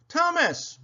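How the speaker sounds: high, whistle-like happy call; Stanley, whistling. A narrator's reading. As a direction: call the name in a high pitch. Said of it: "whistled Stanley".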